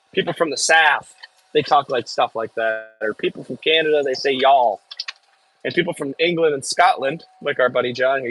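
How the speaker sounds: normal talking voice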